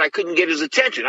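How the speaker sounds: ordinary talk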